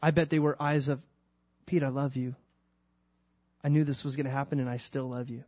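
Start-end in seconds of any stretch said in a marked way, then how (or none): 1.7-5.4 forgive him gently; tender forgiveness. Pretend. Imagined loving Jesus.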